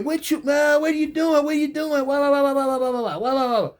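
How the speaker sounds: high nagging mimic trailing into wah wah; mocking the boss lady. Inmate mocking his nagging boss lady, high whiny mimic. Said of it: "she would always".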